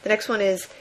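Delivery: ordinary delivery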